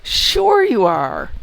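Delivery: slow, loud mock disbelief; sarcastic disbelief. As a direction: scoff at the caller's claim. Mocking the scam caller's claim.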